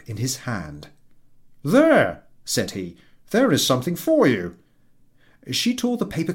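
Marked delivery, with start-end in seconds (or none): 1.6-2.2 higher, louder delighted husband; a pleased husband savouring his soup; husband's voice. Acted. Husband over dinner, higher and delighted. 3.3-4.5 higher, louder delighted husband; a pleased husband savouring his soup; husband's voice. Acted. Husband over dinner, higher and delighted.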